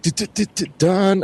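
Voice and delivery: high, stuttered chatter of someone freezing; imitated teeth chattering. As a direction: mimic a shivering friend's rattling teeth. Imitates friend shivering at night.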